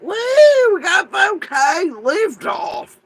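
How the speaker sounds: high, loud, drawn-out cat cheer; cat's voice. Retold or imitated. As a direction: cheer it slowly like a rocket launch. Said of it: "My cat went".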